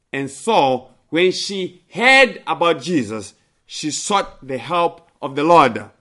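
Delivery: usual speaking voice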